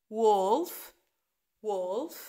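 wolf with clear W; W sound. Shown as a demonstration. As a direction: round the lips on Wolf. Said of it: "هنطق ال W".

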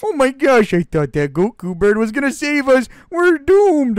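high, loud wail of doom; despairing turkey. Acted. Abandoned turkey wailing they are doomed, high loud despair. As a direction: wail that all is lost.

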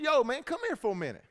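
high re-enacted holler; calling out. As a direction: call a man over from a distance. Re-enacts calling an inmate over.

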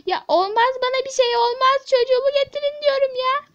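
loud, high insistent protest; agitated protest. Acted. Mother brushing off the nurse, loud agitated demand. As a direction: brush off concern and demand, voice climbing. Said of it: "sakin olmanız lazım".

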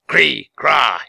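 harsh two-note bird cry; croaked bird call. A narrator's reading. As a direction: croak an order like a raven. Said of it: "he croaked to one of his bodyguards".

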